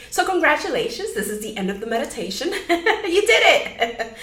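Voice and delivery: hammed-up congratulations with a chuckle; mock congratulations. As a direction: celebrate as a joke, laughing. Jokes that meditation is over.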